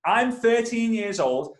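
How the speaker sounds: ordinary talk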